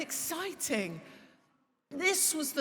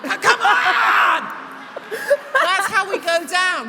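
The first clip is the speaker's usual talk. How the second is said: slow loud cry breaking into laughter and tears; laughing rally cry; 7 semitones higher, 11 dB louder, slower than usual. Real, not acted. Cheering on the crowd, laughing loud cry. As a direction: shout it, laughing and close to tears.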